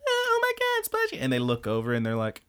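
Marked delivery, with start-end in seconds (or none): 0.1-1.2 very high falsetto mock squeal; tiny, silly falsetto shriek without extra volume; squealing couple. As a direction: imitate a giddy couple splashing about. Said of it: "they hear the couple".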